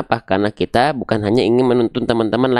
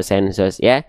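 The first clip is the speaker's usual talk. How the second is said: ordinary talk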